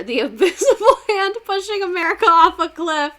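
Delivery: high and loud, laughing through the words; cracking up mid-sentence; giggling hard at her own joke. Real, not acted.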